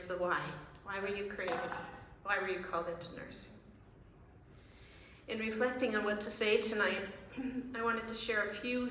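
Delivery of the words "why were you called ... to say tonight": usual speaking voice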